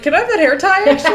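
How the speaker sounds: high, loud mock request; silly high voice. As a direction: squeak it out as a joke. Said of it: "He was like".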